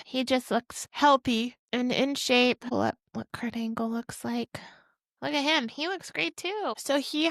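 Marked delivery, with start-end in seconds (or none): 2.6-4.6 whispered aside, unvoiced. Real, not acted. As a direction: whisper it off-mic to the crew. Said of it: "quiet aside to production".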